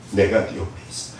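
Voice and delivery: low, whispered reassurance; hushed voice of God. Retold or imitated. God quietly telling her he is beside her, whispered. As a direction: breathe it gently, close by.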